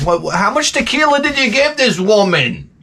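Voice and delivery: high, incredulous demand; mock exasperation. Husband asks who got wife drunk, high mock exasperation. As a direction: demand answers in comic dismay.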